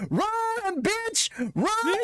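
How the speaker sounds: very high frantic mock yell. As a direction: yell the warning in a squeal. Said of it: "It was like".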